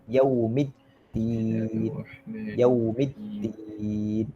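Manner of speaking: "Yaumiddin" very slow, with a clear au; correct diphthong. Shown as a demonstration. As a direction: draw out "Yaumiddin", gliding a to u. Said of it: "Bukan yo".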